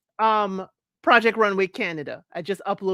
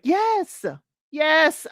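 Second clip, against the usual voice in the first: emphatic yes; 6 semitones higher than usual. Real, not acted. Emphatic agreement.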